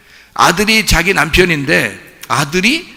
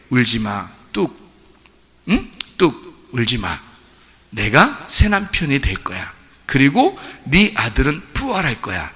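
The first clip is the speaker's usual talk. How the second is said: tender comforting; 3 semitones lower than usual. Acted. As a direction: hush her crying gently. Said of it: "측은히 여기고 불쌍히 여기고".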